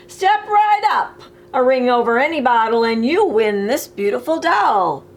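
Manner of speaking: brassy ring-toss barker pitching the prize; carnival barker. Acted. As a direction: call the crowd in like a fair barker.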